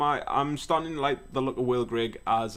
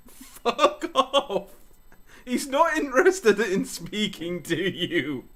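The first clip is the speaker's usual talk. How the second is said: higher, slow incredulous; mock indignant; 7 semitones higher, much slower than usual. Real, not acted. Rejecting Chelsea's third bid, mock outrage. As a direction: drag it out in disbelief.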